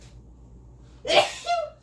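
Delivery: sudden high sneeze. Real, not acted. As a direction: let out a sharp sneeze. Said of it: "Chili flakes got up my nose".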